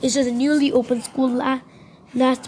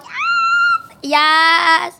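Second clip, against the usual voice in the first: much higher shouted yes; joyful cheer; 6 semitones higher, 3 dB louder than usual. Pretend. Student cheering loudly at the principal's news. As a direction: cheer like you just won.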